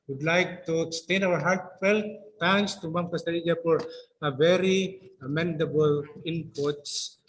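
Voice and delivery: ordinary delivery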